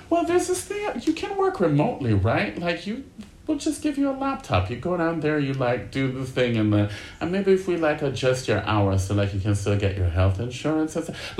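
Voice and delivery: high persuasive boss voice. Retold or imitated. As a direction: coax them along up high. Boss and chief of staff quoted.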